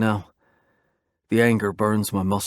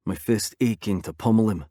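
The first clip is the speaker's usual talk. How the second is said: plain reading voice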